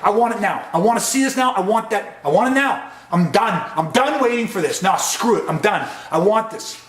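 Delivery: insist, losing patience; demanding outburst. Shown as a demonstration. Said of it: "you just get to these levels".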